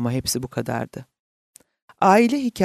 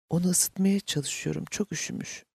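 soft, higher, fading toward a whisper; hushed; 4 semitones higher, 6 dB quieter than usual. A narrator's reading. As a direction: speak softly, almost whispering. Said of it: "Ona fısıldıyordu". (kısık sesle, fısıltıya yakın)

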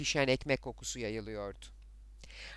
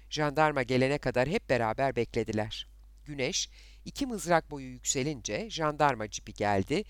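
plain reading voice

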